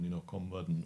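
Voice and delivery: ordinary talk, nothing marked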